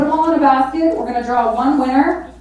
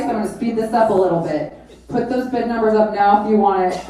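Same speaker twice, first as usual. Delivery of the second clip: ordinary talk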